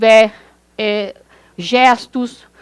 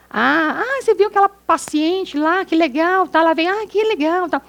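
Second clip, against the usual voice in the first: enthusiastic; 6 semitones higher than usual. Shown as a demonstration. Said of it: "a entonação do que eu falo".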